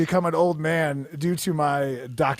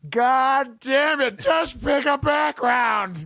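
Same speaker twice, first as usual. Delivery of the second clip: mock exasperated shout; 8 semitones higher, 4 dB louder, much slower than usual. Urging Willis to hurry.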